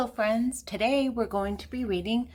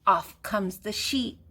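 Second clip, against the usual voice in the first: plain reading voice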